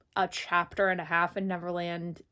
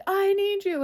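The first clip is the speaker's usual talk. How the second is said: high, loud, hammed-up plea; mock plea; 11 semitones higher, 7 dB louder than usual. Peter begging Wendy from the car, high loud plea. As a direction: beg her, overplayed and loud. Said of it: "And then Peter's like".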